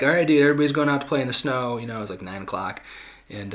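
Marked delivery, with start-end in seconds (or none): 0.0-1.8 put-on higher, louder, quick call to the kids; rally a child to get moving, brisk and loud; retold rousing parental call; upbeat parent rallying.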